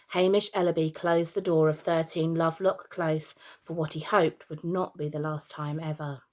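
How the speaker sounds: ordinary delivery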